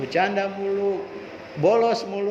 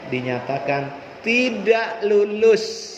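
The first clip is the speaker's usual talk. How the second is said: slow formal reading; 3 semitones higher, much slower than usual. Retold or imitated. Reading the exam result.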